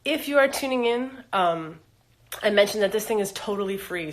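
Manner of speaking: usual speaking voice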